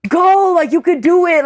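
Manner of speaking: high-pitched pep talk, imitating a pushy friend; mock egging him on, pitch jumped up; urging a friend on. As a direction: push a nervous friend to go. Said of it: "trying to, like, force him to go".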